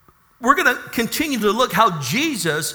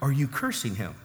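quiet pointed question; 10 dB quieter than usual. Retold or imitated. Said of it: "I go".

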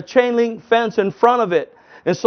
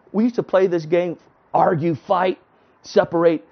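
normal talking voice

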